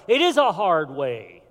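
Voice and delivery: normal talking voice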